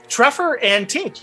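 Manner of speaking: usual speaking voice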